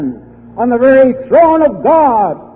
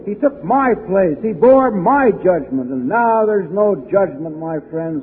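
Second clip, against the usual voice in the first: ordinary talk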